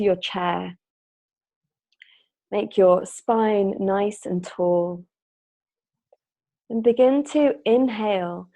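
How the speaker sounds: unhurried meditation guide with long pauses; measured, spacious instructions, phrase by phrase; guided meditation. Real, not acted. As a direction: lead them gently into the breath.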